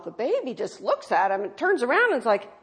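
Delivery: ordinary delivery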